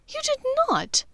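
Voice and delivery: very high, astonished cry; shocked disbelief. Acted. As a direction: gasp in shocked delight. Shocked girlfriend.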